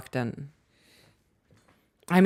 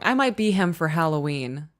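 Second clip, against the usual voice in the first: ordinary talk, nothing marked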